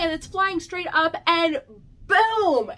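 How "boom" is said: loud higher boom of impact; sudden loud boom; shouted boom. Retold or imitated. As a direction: punch out the impact. Impact sound effect.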